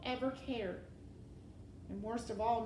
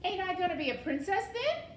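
put-on high, loud, fast girl's question; serving-girl voice; 10 semitones higher, 8 dB louder, faster than usual. A narrator's reading. Mig asking the rat about her promise, high and loud. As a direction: blurt it high and quick.

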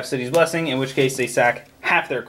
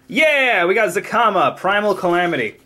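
excited; 5 semitones higher than usual. Real, not acted. Cheering at a legendary pull.

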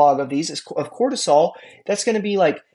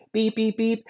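mock alarm beep, pitched higher; imitated alarm beeping; 5 semitones higher, 4 dB quieter than usual. Miming a student's alarm at homework, mock beeping. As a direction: beep like a small alarm going off. Alarm response mimed again.

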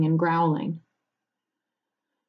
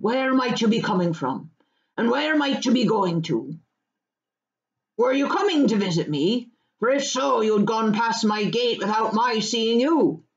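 slightly higher rustic questioning; country farmer, probing; 3 semitones higher than usual. Acted. Farmer questioning Pippin over beer, probing. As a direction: quiz the visitors with friendly suspicion.

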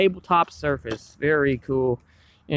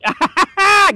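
excited laugh; 5 dB louder than usual. Real, not acted. Remembers Bourbon Street.

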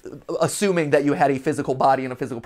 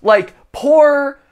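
mock pity; 7 semitones higher, 7 dB louder, much slower than usual. Real, not acted. Pitying a drag queen.

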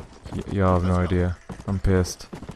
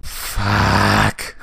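long, loud drawn-out curse; angry yell; 6 dB louder than usual. Real, not acted. Gamer juked into the water, frustrated yell. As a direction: stretch the swear out in real frustration.

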